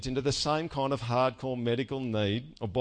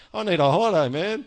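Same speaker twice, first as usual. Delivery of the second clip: louder, fed-up cry for a holiday; stressed worker pleading for a holiday, louder; exasperated plea; 5 semitones higher, 5 dB louder than usual. Pretend. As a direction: sigh it out like a worn-out worker after a messy day. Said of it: "messy day at work, someone asks".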